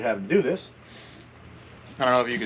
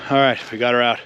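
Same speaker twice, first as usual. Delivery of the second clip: panting after heavy lifting; winded, catching breath. Real, not acted. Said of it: "Disregard that I'm out of breath".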